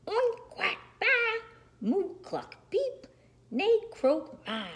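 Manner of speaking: high, excited farmyard roll call; animal noises. A narrator's reading. Animals climb aboard the truck, high cries. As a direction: chirp out each animal call in turn.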